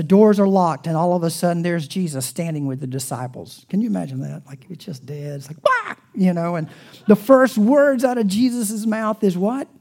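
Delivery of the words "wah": mock startle yell. Jesus suddenly appearing in the locked room, mock startle yell. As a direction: pop out and startle them.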